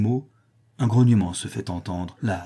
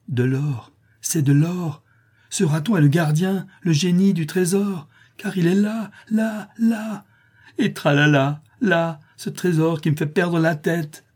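much higher, straining frenzied cry about the gold; a man losing his head over gold; strangled cry; 7 semitones higher than usual. A narrator's reading. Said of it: "crie d'une voix étranglée".

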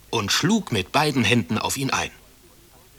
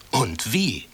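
plain reading voice

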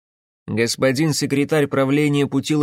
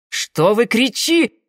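high, slow outraged protest; indignant; 10 semitones higher, 5 dB louder, slower than usual. Acted. Гунькин возмущённо одёргивает кричащего Карпаса, высоко и медленно. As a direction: protest sharply, then stop short. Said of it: "возмутился Гунькин и осёкся".

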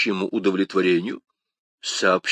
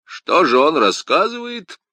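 pompous, a bit louder, pitched up; self-important question; 7 semitones higher, 5 dB louder than usual. A narrator's reading. Porthos asks the gathered musketeers pompously. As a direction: ask it with great importance. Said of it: "с важностью спросил Портос".

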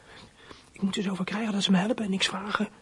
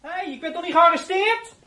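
much higher, loud shouting through the door; 10 semitones higher, 6 dB louder than usual. Acted. Locked-up boy shouting at the police to open the door.

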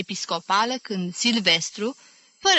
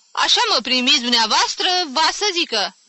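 faster and higher, wounded pride; offended; 8 semitones higher, faster than usual. A narrator's reading. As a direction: protest the cold welcome. (jignit, mai repede)

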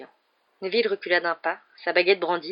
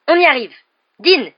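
loud, high teacher's cheer; calling out; 8 semitones higher, 10 dB louder than usual. A narrator's reading. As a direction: urge the class on and summon the next pupil. Teacher calling the next pupil. (encouragement lancé haut et fort)

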